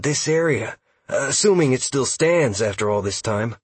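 eager elf scout. Acted. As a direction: explain it like an eager lore nerd. Narrator voices elf scout Huxley.

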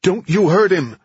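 high, loud panicked scream; desperate yell. Acted. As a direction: scream it from inside the trunk. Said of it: "A muffled voice yelled from the caddy's trunk".

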